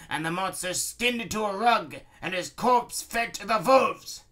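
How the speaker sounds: baron's threatening tirade. Acted. Baron ordering war on the cat princess, threatening. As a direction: rant out the war orders, building.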